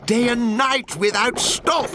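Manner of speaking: the Lorax tells off the factory owner; scolding Lorax voice. Acted.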